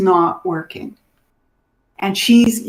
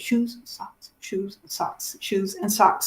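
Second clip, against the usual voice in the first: softer repetition of the words, holding on to them; muttered to herself; 6 dB quieter than usual. Retold or imitated. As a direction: repeat it under your breath to remember. She repeats the words to remember.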